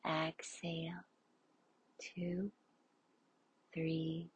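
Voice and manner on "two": normal talking voice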